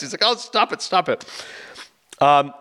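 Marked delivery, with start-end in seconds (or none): 0.2-1.2 higher-pitched, playful scolding; imitated flustered objection; mock protest. Client protesting her escort's crude phone joke, mock scolding.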